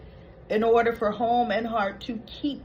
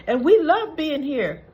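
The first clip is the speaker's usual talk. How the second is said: high, louder show of enthusiasm; mock gushing; 5 semitones higher, 6 dB louder than usual. Co-hosts saying they love being here, gushing. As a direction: lift it up into a gush.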